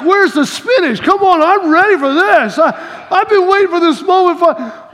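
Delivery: high, revved-up fighting talk; eager hype. Retold or imitated. Boy finally allowed to fight Chucky, mock eager hype. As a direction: play a kid raring to go.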